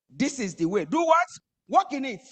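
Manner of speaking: loud, high, impassioned preaching; fiery preaching. Acted. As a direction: preach it out with full force.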